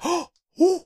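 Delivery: high hammed-up awestruck whoo; Chris Pratt amazed whoo. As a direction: act stunned like a star on a zoom-in. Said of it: "the picture of Chris Pratt when they zoom in and he's like".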